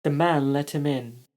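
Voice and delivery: ordinary delivery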